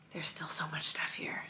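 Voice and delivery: soft unvoiced whisper. Real, not acted. Mum overwhelmed by packing, whispering. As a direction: let it out as a weary whisper.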